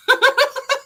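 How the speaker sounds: high, loud burst of laughter; high, loud laugh at the absurd news. Real, not acted.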